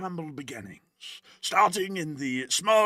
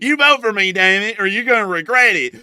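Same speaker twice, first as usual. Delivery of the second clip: higher and louder, hammed-up threat; mock threatening yell; 5 semitones higher, 8 dB louder than usual. Candidate threatens Southern voters, loud mock yell. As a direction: bark it like a bully candidate. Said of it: "I'm just gonna be".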